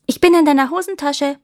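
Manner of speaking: a hint of a hidden girl, lifted; lightly voiced girl. A narrator's reading. Hidden girl answers the officer.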